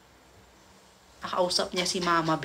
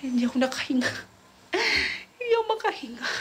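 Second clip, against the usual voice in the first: laughing; 7 semitones higher, much faster than usual. Retold or imitated. Tawa sa sariling reaksyon.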